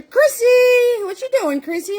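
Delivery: high, loud delighted hello; light up at seeing her; excited greeting. Real, not acted. Host excitedly greeting a long-missed viewer by name.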